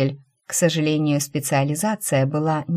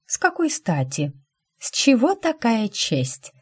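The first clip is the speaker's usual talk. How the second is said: incredulous; 7 semitones higher than usual. Acted. Incredulous question.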